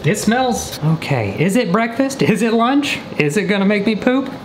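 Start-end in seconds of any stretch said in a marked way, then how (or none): none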